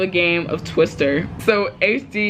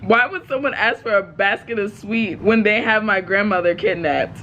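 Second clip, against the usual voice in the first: ordinary delivery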